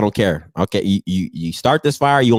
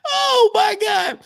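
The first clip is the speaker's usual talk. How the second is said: high, slow, tearful laughter; laugh-crying; 12 semitones higher, slower than usual. Real, not acted. After the Stephon roast, laugh-crying. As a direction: gasp it out through tears of laughter.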